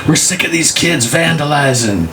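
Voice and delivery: fed-up watchman. Acted. Watchman sick of vandalizing kids, comic indignation. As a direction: grumble high in a put-on voice.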